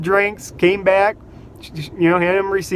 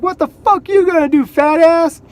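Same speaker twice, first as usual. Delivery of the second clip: much higher, whiny jeering voice; mocking college kids; 10 semitones higher, 4 dB louder than usual. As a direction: mimic rude kids heckling from their car. College kids taunting him.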